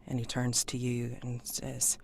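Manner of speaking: ordinary talk, nothing marked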